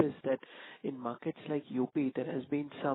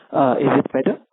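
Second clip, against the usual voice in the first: much louder, checking it can be heard; speaking up; 15 dB louder than usual. Real, not acted. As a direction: raise your voice for a bad line. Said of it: "Can you please speak a bit louder".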